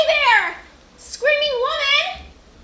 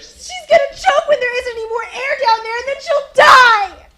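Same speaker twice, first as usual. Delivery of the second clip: sobbing, loud and desperate; crying shout; 4 dB louder than usual. Acted. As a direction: wail it through tears. Girl distraught about dying woman.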